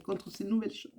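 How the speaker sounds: voix coupée par le hoquet; hiccuping. Real, not acted. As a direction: parler entre deux hoquets. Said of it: "j'ai le hoquet". (avec le hoquet, voix étouffée)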